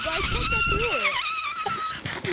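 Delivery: wheezy voice. Real, not acted. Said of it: "The hell?".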